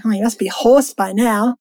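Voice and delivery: normal talking voice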